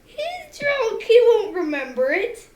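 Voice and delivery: loud, high, swooping self-defence; high-pitched protest. Real, not acted. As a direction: squeal your excuse loudly. Player defends hitting drunk friend.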